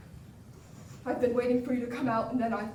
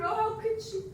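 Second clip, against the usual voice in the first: ordinary talk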